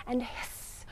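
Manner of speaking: whispered hiss; unvoiced hiss cue; snake hiss. Shown as a demonstration. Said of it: "snakes go hiss".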